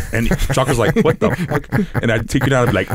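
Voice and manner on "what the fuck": higher-pitched retold disbelief; mock bafflement. Teller voices his partner reacting to random numbers, baffled. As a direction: imitate a baffled training partner.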